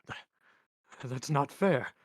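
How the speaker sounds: high, loud whine; whining. A narrator's reading. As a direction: protest like a whiner, stumbling into it. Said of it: "Now it was a whine".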